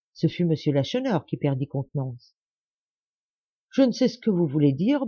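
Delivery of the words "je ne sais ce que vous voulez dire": ordinary delivery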